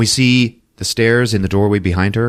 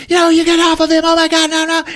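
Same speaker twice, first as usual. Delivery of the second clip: very high, loud, frantic protest; hammed-up screaming while restrained; mock frantic struggle; 5 dB louder, faster than usual. Wife held down by task force, mock hysterical protest. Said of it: "holding her down and she's like".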